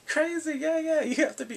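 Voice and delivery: high-pitched words through mock laughter; imitated laughing. Father amused by her answer, imitated laughing. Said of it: "then he starts laughing".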